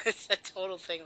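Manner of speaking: ordinary talk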